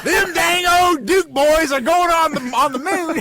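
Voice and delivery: high hillbilly twang. Pretend. Southern hick voice.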